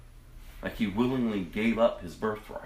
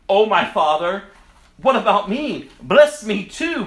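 high, loud, anguished plea; loud bitter cry; 9 semitones higher, 10 dB louder than usual. Acted. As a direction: cry out to his father in despair, slowing on the last words. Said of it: "he let out a loud and bitter cry".

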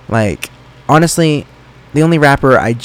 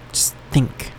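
whisper; 8 dB quieter than usual. Real, not acted. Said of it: "whispered instruction to listener".

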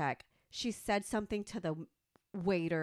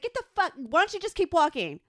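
much higher, louder reenacted yell; retold shout; 7 semitones higher, 8 dB louder, faster than usual. Yelling after the old lady as she leaves, reenacted shout. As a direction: yell it after her as she walks away.

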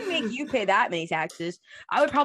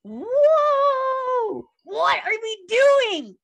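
far higher, shouted mock crowd roar; crowd cheer; 8 dB louder, much slower than usual. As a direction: scream like a hyped crowd, slow. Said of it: "And everyone goes".

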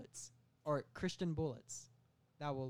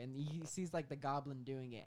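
ordinary talk

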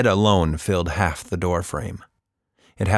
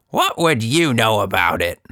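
mild anger; 3 semitones higher, 4 dB louder than usual. A narrator's reading. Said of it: "Anita slammed her fist down".

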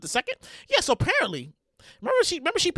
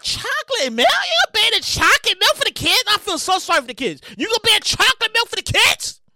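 high-pitched disbelief; worked-up, disbelieving squeal; incredulous outrage; 8 semitones higher than usual. Real, not acted. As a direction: question it in a high, scandalized voice. Outraged at chocolate milk ban.

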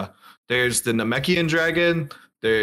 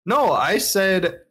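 ordinary delivery

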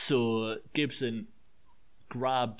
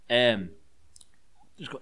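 ordinary talk

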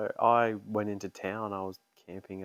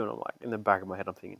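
ordinary talk, nothing marked

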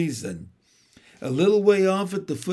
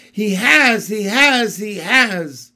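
louder, higher, frantic squeak; a little squirrel squeaking in panic; 3 semitones higher, 7 dB louder, slower than usual. A narrator's reading. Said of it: "it squeaked".

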